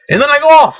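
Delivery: normal talking voice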